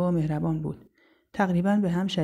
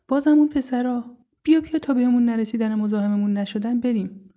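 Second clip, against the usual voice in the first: higher, louder anxious warning; alarmed urging; 5 semitones higher, 5 dB louder than usual. Acted. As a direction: hurry a friend away from trouble. Friend urges escape from boys. (هشدار نگران و عجولانه)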